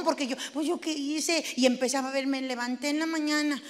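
ordinary talk, nothing marked

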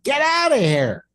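high-pitched retold yell. Man re-enacting yelling at a squirrel in his apartment, yelling. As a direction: yell it high to drive an animal off. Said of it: "I start yelling at him".

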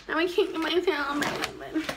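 ordinary talk

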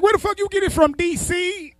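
high, loud uncle demanding where it came from; uncle's voice. Retold or imitated. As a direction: pitch it way up like the old uncle. Retelling uncle asking where weed came from.

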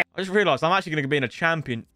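ordinary talk, nothing marked

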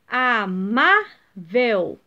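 "Amável" slowly broken up, "má" long and strong; penultimate stress. Shown as a demonstration. Teacher giving pupils another example, stressing "má" in slow "Amável". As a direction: split "Amável" and punch the middle "má". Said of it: "também é a penúltima".

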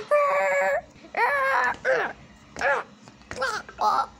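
high, loud short shrieks; hammed-up screams. Player screaming on purpose for the killer, high short shrieks. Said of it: "I'm screaming so loud".

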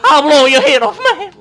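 high, loud hammed-up threat; mock threat shout. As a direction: yell it like a cartoon gangster. Threatening game character.

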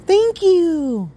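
high, bright burst of thanks; thrilled the cat finally did it; excited thanks. Real, not acted. Cat finally does the meow.

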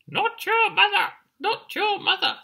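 very high, loud bird squawk. Acted. Birds in the trees warn the chick, high squawk. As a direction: squawk a piercing warning in falsetto. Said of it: "squawked the birds in the trees".

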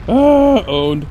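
loud, high triumphant cheer; excited. Real, not acted. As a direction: crow over the kill. Teammate wrecks enemy.

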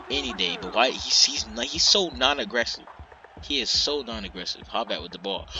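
ordinary delivery

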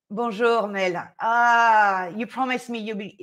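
normal talking voice

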